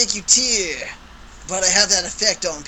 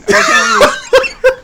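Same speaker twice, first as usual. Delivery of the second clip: laughing; 6 dB louder than usual. Real, not acted. Laughing after dropping phone.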